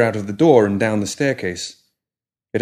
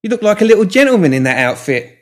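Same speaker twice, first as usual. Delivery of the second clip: put-on higher, louder bright young woman; cheerful young woman; 11 semitones higher, 7 dB louder than usual. A narrator's reading. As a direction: voice her brisk and eager. Molly teasing the pram.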